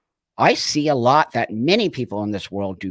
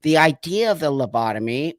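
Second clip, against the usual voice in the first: ordinary talk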